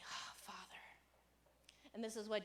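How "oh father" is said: soft whispered turning to God; whispered prayer. Retold or imitated. Preacher whispering a prayer to the Father. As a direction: breathe it like a quiet prayer. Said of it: "turn our hearts and say".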